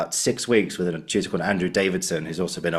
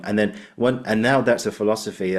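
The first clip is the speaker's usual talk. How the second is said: usual speaking voice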